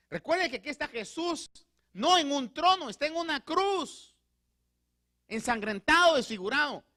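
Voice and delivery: full-force pulpit fervour; fervent preaching. Acted. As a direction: build to a ringing climax.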